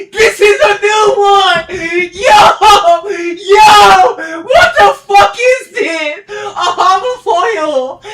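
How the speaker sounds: high, loud hyped shouting; real gamer hype with a laugh at the end; excited yelling. Real, not acted. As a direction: lose it over the loot. Finds rare loot, yelling.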